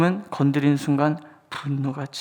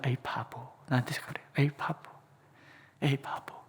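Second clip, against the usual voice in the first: muttered self-scolding; 11 dB quieter than usual. Retold or imitated.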